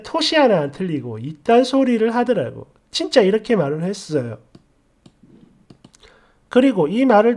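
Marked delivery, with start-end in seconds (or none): none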